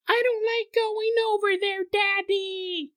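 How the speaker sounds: put-on high, slow, pleading child voice; a frightened toddler begs Daddy; small, slow child's plea; scared little girl. Toddler daughter voiced in story.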